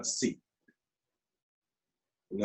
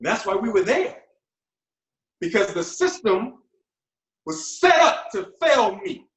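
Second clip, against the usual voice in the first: impassioned anger; 7 semitones higher than usual. Acted. Angry testimony about an unjust system.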